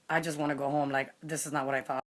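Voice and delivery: low, subdued inner grumble; imitated glum muttering. Bored dater to herself on the awkward date, glum. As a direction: say it low and very softly, mock-dejected.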